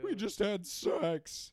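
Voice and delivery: slow, deadpan movie line; Patrick Warburton voice. Retold or imitated. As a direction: stretch each word deadpan. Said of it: "he's like".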